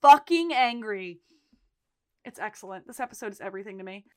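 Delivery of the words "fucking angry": slow, louder, fed-up rant; real anger. Real, not acted. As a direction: lean into the fury on the end. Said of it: "makes me so fucking angry".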